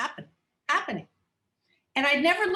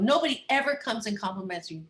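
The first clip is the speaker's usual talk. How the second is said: ordinary talk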